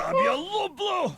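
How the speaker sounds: high, loud call in a made-up language; high shout. Acted. Character shouts a greeting.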